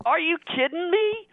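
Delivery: high, incredulous disbelief; incredulous outburst. Retold or imitated. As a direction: scoff at it, can't believe your ears. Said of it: "I'm like".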